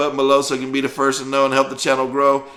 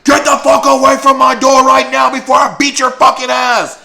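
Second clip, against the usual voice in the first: high, loud furious screaming; she-devil screaming; 14 semitones higher, 9 dB louder than usual. Retold or imitated. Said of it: "she turned into a she-devil".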